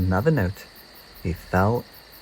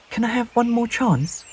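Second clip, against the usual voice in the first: begging; 12 semitones higher than usual. A narrator's reading. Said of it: "begged Peep".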